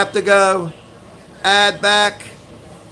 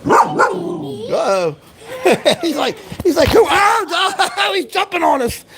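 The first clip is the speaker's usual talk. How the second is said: laughing excitedly; 9 semitones higher, slower than usual. Real, not acted. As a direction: laugh out as the dog leaps on you. Laughing as dog jumps.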